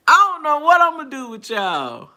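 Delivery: loud, high, falling out of a laugh; playful high-pitched scolding of friends; amused exasperation. Real, not acted. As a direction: exclaim it straight after cackling.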